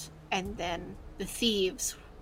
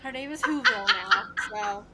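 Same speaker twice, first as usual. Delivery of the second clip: laughing; 12 semitones higher, 6 dB louder than usual. Real, not acted.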